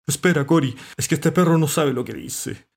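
loud, high plea to wait; urgent interruption. A narrator's reading. Cat stopping the cormorant, loud and urgent. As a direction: cut in to stop him. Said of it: "lo detuvo Abelardo".

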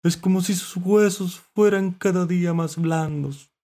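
high-pitched lamenting character voice; ailing mollusc. A narrator's reading. Mollusc lamenting the acid sea, high-pitched. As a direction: complain from under water, high.

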